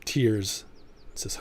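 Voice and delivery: ordinary delivery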